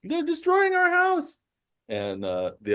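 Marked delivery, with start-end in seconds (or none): 0.0-1.4 very high squeaky outcry; distraught kobold. Acted. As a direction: report the outrage in frantic falsetto. Kobolds report to rescuers.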